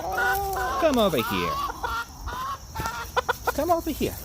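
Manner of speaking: very high sing-song call to the rooster; high pet-calling voice; calling a chicken. Real, not acted. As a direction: coax him over in a high lilting voice.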